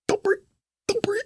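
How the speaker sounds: very high, squeezed panicky squeak; comic tiny strained whimper; strained breath-holding. Retold or imitated. Holding breath inside scanner, acted.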